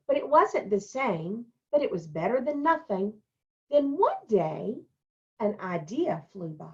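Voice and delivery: put-on brighter storytelling voice for the children; story reading. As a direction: read it like a picture book to kids. Teacher reads picture book aloud.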